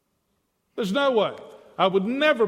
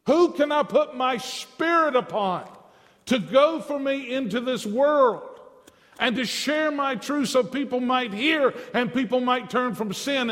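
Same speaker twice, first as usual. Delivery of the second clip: higher, louder, urgent calling voice; a preacher voicing God, higher and louder; pleading voice of God; 6 semitones higher, 3 dB louder than usual. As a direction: cry out as God searching for a messenger. Preacher voices God's call.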